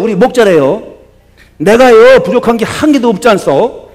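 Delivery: Psalm 23 in a light Gangwon dialect, loud; cheerful Gangwon-dialect recitation with "-요" endings; lightly hammed Gangwon dialect. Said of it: "강원도 사람이 가만히 안 있어".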